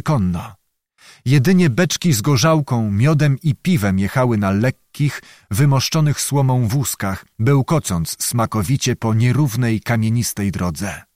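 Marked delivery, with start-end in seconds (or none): none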